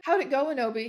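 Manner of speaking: ordinary delivery, nothing marked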